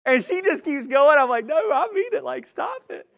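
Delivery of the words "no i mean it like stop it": insistent. Retold or imitated. Embarrassed husband insists wife stop.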